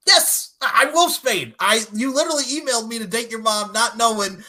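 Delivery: exasperated retort. Retold or imitated. Vampire answering about the email, mock exasperation.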